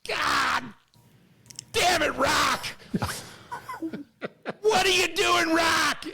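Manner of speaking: high, shouted coach yell. Retold or imitated. Early-morning coach Ray losing it at Rock, high shout. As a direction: blow up at the player across the court. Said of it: "he's like".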